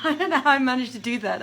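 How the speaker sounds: laughing it off, fast and loud; flustered giggle through the words; embarrassed laugh at her own mistake. Real, not acted. Flustered by restarting music.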